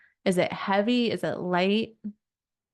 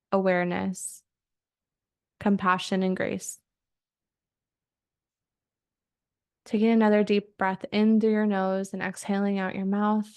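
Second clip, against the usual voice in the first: guided meditation. Acted. Coach guides her group through breathing, calmly. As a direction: lead them calmly into their bodies.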